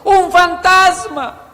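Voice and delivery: high, loud, stretched-out scream of words; acted cry of alarm; frightened shout. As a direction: shout it out like terrified men. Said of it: "ellos empezaron a gritar".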